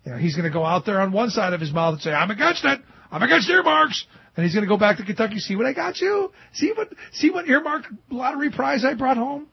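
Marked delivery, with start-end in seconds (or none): 2.1-4.0 high, emphatic politician's posturing; mock indignant declaration. Host mimics senator posturing for voters, mock indignant. 5.4-9.5 high-pitched, wheedling brag; mock boasting. Host mimics senator back in Kentucky, mock boasting.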